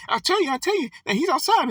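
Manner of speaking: high, shrill-pitched desperate plea; hammed-up desperate woman insisting; frantic pleading woman. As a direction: beg like a frantic woman nobody believes. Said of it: "grab on her clothes and, you know, shaking".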